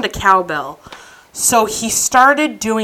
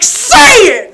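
very high, loud mock order; yelled demand; 7 dB louder than usual. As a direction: imitate forcing out a confession. Acting out demand for confession.

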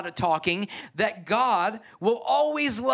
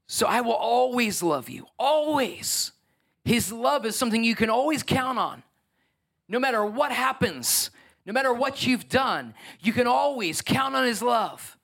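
rising preaching; 4 semitones higher than usual. Real, not acted. Preacher builds on God's love.

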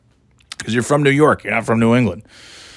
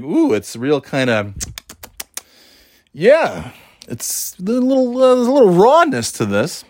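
wary taster; 8 semitones higher than usual. Retold or imitated. Tasting undercooked burger.

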